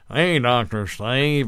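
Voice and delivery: slow, drawn-out put-on caller; fake caller voice. Shown as a demonstration. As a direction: drawl it like a prank caller. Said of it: "voice, and then I'll speed it up".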